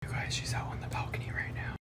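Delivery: hushed whisper so she sleeps. Real, not acted. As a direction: whisper it close to the mic. Hiding prank from girlfriend.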